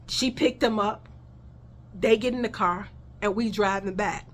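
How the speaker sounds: usual speaking voice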